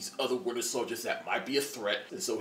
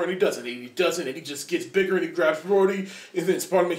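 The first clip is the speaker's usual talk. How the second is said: ordinary talk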